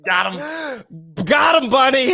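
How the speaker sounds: gleeful triumphant shout. Real, not acted. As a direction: crow over the comeback. Gloating over a roast.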